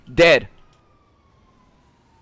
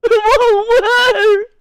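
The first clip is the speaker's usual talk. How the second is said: slow, high mock-crying character voice; tearful version of the high put-on voice; crying variation; 6 dB louder, much slower than usual. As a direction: drag it out in a put-on wail. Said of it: "I can do variations of it".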